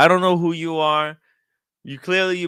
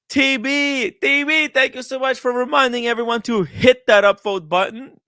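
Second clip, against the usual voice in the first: high cheery thanks; 9 semitones higher than usual. Acted. Cheery thank-you to a chat donor.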